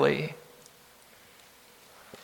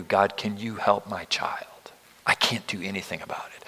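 much softer, breathy whisper to God; desperate whispered prayer; 6 semitones lower, 8 dB quieter than usual. Retold or imitated. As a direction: whisper it like a helpless parent at a bedside.

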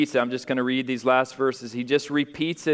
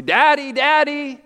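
put-on high, loud child's call, voice raised to a cry; frightened child calling; 13 semitones higher, 7 dB louder than usual. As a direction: call out like a scared child half-awake.

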